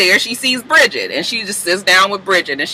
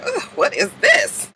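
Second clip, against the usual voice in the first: disgusted Hazel; 13 semitones higher, 5 dB quieter than usual. Retold or imitated. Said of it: "Hazel watching just like".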